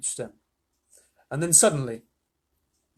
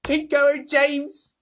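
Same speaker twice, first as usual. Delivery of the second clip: high, loud cheer; crying out; 12 semitones higher, 6 dB louder, much slower than usual. A narrator's reading. Insects spurring James on, loud urging cry. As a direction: shout encouragement. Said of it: "they cried".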